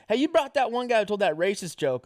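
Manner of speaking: mock complaint, pitched well up; accusing booker. Club booker confronting the comic about his friend, mock accusing.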